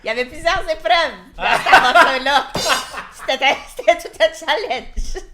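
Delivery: loud, high, giggling through it; laughing. Real, not acted. Laughing over the gift question.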